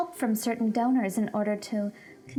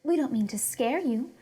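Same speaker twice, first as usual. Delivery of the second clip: usual speaking voice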